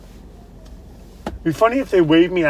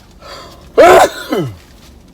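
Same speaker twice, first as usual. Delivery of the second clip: coughing; 3 semitones higher, 9 dB louder than usual. Real, not acted. Coughing after the nose swab.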